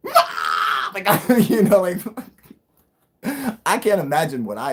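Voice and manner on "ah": high, loud recreated yelp; imitated scream. Retelling the near crash to viewers, a high loud scream. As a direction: replay the scream from the car moment. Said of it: "I must have been like".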